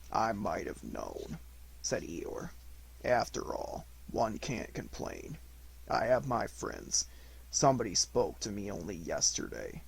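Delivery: slow, low, mournful Eeyore; dreary, self-pitying donkey; gloomy donkey. Acted.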